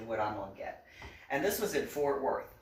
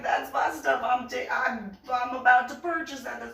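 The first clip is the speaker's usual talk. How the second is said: indignant; 7 semitones higher, 5 dB louder than usual. Retold or imitated. Confronting lady over the bag.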